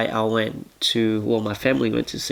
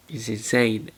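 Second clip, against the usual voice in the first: normal talking voice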